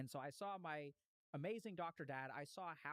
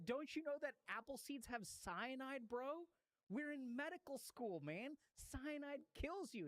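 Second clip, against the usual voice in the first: alarmed warning; 9 semitones higher, slower than usual. Retold or imitated. Students warn about cyanide.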